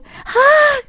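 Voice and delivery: very high-pitched shocked "Ah!"; sudden shriek of surprise; startled cry. Acted. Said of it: "하면서 엄청 크게 놀랐고요".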